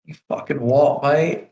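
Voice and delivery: mock Cockney challenge; Cockney accent. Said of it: "Cockney accent".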